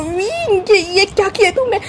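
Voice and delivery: high strained cry after being kicked; doubled over, squeaking in pain; pained high-pitched wail; yelp of pain. A narrator's reading. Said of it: "वांगजी ज़ोर से चिल्लाता है".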